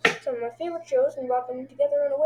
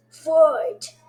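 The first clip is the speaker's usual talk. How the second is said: loud, raised shout to start a bout; announcer's fight call; 5 semitones higher, 9 dB louder than usual. Retold or imitated.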